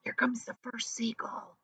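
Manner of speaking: unvoiced, hushed whisper. A narrator's reading. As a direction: breathe it so the gulls won't hear. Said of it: "whispered James to the earthworm".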